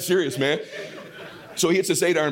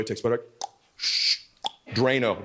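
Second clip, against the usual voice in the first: normal talking voice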